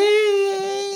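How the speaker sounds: very high, drawn-out mimicry; mocking put-on voice. Host mocking friend's sudden voice, high mimicry. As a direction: squeal the greeting to mock a friend. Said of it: "all of a sudden you do a voice".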